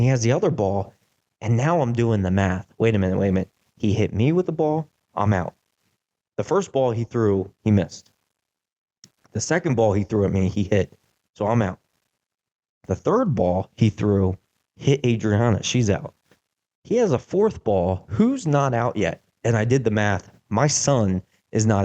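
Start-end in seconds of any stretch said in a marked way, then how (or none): none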